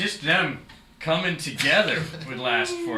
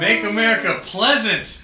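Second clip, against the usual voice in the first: slogan shout; 9 semitones higher, 11 dB louder than usual. Pretend. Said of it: "slogan proclaimed".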